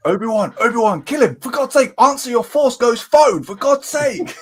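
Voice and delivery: hammed-up desperate yell; mock exasperated urging. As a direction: call out urgently, as if he won't pick up. Acting out a ghost urging Obi-Wan.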